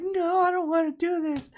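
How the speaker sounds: high, loud mock wail of refusal; crying little boy. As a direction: whine like a tearful, balking child. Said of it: "he was crying his eyes out".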